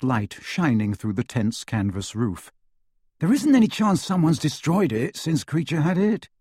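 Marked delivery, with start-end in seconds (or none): 3.2-6.3 higher-pitched, hopeful question. A narrator's reading. Ron asks if the locket could be destroyed, hopeful. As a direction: ask wishing for a yes. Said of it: "asked Ron hopefully".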